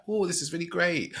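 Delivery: mock elation. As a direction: ham up being on a high. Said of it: "And he was like".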